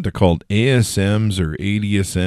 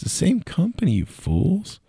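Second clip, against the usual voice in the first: much higher, disbelieving cry of "what"; incredulous outburst; 3 dB quieter, slower than usual. Retold or imitated. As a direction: act out your shock at their stupidity. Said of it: "And I'm like".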